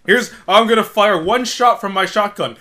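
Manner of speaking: loud, high blaring announcement; hammed-up shouted action line; mock movie shouting. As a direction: bellow it like an overloud action film. Mocking how loud the film was.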